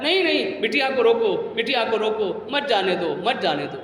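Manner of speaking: ordinary talk, nothing marked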